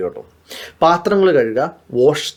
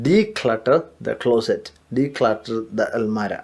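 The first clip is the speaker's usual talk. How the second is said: very slow, lower and softer; slow model phrase; 5 semitones lower, 6 dB quieter, much slower than usual. Shown as a demonstration. Teacher modelling a new word slowly for students. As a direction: stretch out each word of declutter the closet. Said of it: "മറ്റൊരു വാക്കും പറയാം".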